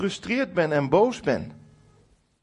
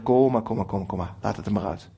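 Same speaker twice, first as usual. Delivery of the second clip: gentle inviting God; 7 semitones lower than usual. Retold or imitated. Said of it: "Ik denk dat hij zegt".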